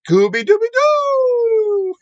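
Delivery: high, slow cartoon dog voice; stretched-out cartoon dog call; Scooby-Doo voice. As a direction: announce him like Scooby himself would. Host introduces guest as Scooby.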